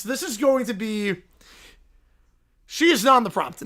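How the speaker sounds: usual speaking voice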